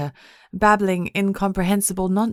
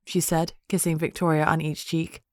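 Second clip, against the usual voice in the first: usual speaking voice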